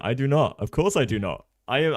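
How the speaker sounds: ordinary talk, nothing marked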